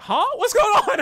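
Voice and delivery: very high, loud startled yelp; baffled. Retold or imitated. Viewer reacts to the mafia boss's odd talk, baffled. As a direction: burst out in comic disbelief.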